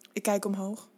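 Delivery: usual speaking voice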